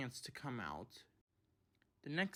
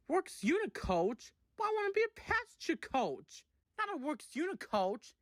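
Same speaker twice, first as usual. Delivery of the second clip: high, loud indignant outburst; offended coach fuming at being a works unit; 12 semitones higher, 4 dB louder than usual. Acted. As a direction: fume at the very idea. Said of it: "she fumed".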